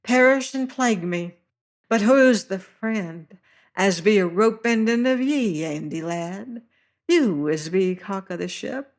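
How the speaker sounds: high fluting voice of a fat seaman; high-pitched voice. Acted. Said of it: "high-pitched voice".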